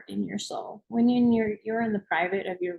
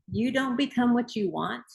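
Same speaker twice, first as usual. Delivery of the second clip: normal talking voice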